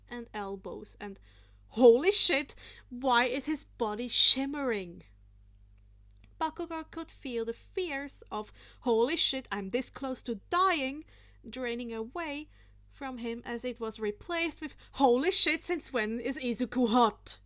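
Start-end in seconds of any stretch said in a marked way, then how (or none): 1.7-5.0 loud stunned exclamation; villain yelling in shock; shocked outburst. Acted. 8.8-11.0 loud panicked thought. Acted. Bakugo's inner panic. 14.9-17.4 loud flustered exclamation; stunned. Acted. Bakugo's stunned realization.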